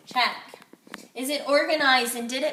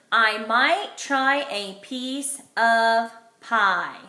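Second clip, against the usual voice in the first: every word slow and separate, clearly louder; slow model sentence; 4 dB louder, much slower than usual. Shown as a demonstration. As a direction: read it aloud slowly, like a model sentence for the class. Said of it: "today my sentence is".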